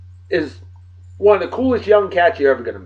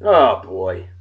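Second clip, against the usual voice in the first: ordinary delivery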